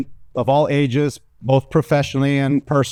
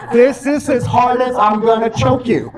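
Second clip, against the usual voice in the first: mock domineering girlfriend; 8 semitones higher, 3 dB louder than usual.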